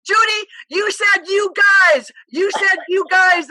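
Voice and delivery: high, loud, slowed triumphant shout; a loud, high, slow teasing exclamation; excited gotcha. Retold or imitated. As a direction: shout it like catching her out, slowly. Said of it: "I said".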